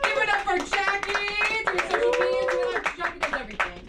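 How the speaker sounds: high, bright emcee announcement; hype host. Acted. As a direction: pump the crowd up for her. Host hyping the team.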